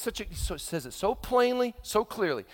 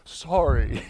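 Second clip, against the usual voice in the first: put-on grudging kid; 6 semitones lower, 4 dB louder than usual. Kid's grudging sorry.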